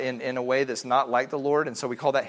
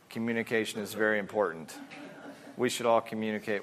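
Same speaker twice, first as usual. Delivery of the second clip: flat bored delivery; 5 semitones lower, 7 dB quieter than usual. Shown as a demonstration. Preacher showing how words sound without heart, lower and softer bored monotone. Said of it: "I could say the exact same words in a different way".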